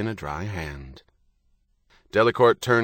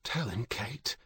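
very soft inner-thought voice; silent urging; 3 semitones higher, 10 dB quieter, slower than usual. A narrator's reading. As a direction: urge her privately, well under normal volume. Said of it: "Stephen thought".

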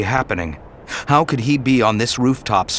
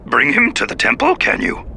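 put-on much higher little old Jedi voice; Yoda voice; 12 semitones higher, 3 dB louder than usual. Yoda answers Anakin over comlink, high and old. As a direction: croak it like tiny old Yoda.

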